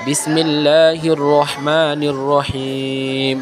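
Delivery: slow, measured Arabic recitation; slow chanted prayer; devotional recitation. Acted. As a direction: recite the blessing slowly and reverently. Recites durood slowly.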